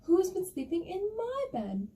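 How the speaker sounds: high-pitched, indignant bear voice; medium bear. Acted. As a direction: lift the voice high for the put-out middle bear. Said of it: "bear goes".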